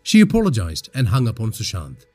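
usual speaking voice

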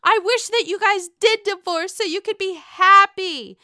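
high, loud mock plea; mock calming. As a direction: daughter urging her parents, high and loud in mock earnest. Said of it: "Trying to calm them down".

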